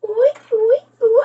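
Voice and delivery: high excited oohs; high thrilled oohs; excited cooing. Real, not acted. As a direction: coo with rising wonder. Excited as the bulb lights.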